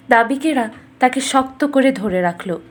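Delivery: usual speaking voice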